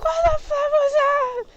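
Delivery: high, loud outraged shout; shrieking at the bug fixer, mock furious; mock outraged yell. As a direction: yell it in disbelief at the modder. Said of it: "I'm gonna be like".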